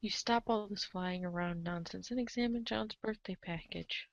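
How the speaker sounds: ordinary delivery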